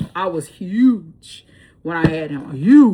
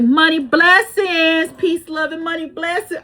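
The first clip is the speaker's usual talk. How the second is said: high, slow sing-song imitation; YouTuber catchphrase; 9 semitones higher, 5 dB louder, slower than usual. Imitating a YouTube lady's sign-off, high and slow. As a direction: mimic the YouTube lady's blessing. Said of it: "there's this lady I watch on YouTube".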